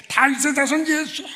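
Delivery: high, loud desperate cry. Acted. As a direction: shout over the crowd with everything left. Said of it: "더 큰 소리로".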